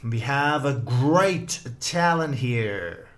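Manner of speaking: slow, drawn-out stage-host introduction; mock announcer. Teacher hypes up his student like a stage host, slow mock announcer. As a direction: present her like a talent-show compère, playfully.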